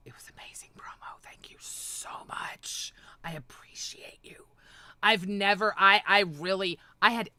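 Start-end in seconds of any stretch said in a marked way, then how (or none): 0.1-4.5 soft whispered mock thanks; whispered aside. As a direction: whisper it like a sly secret. Sarcastic whispered aside thanking critics.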